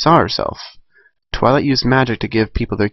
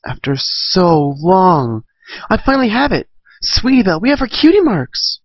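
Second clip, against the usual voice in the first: high, thrilled cheer rising; excited filly; 8 semitones higher than usual. Acted. Filly cheering to her friend about their cutie marks, thrilled. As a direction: squeal with joy at finally having it.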